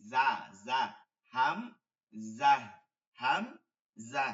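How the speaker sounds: জা said loud and low, clearly sounded out; Arabic syllables repeated for the class; correct pronunciation. Shown as a demonstration. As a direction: drill জা and হাম with force. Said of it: "কিন্তু এটা হচ্ছে কি?".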